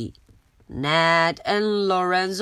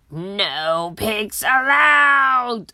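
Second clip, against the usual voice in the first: shout; much slower than usual. Acted. Old lady shouting to chase the pig away. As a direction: bellow it at the pig. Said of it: "Eugenia shouted".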